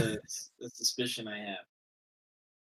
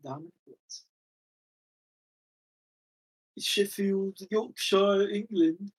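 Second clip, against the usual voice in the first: playful put-on British accent, announcing each place name; hammed-up English accent for Sheffield, Yorkshire, England; jokey British accent, rising at the end; mock British accent; 7 semitones higher, 4 dB louder, slower than usual. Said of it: "I need to stop doing that British accent".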